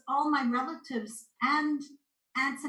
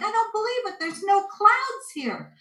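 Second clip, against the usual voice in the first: imitated amazement; 10 semitones higher, 5 dB louder than usual. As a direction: play the astonished driver, slowing at the end. Retold bus driver amazed at sky.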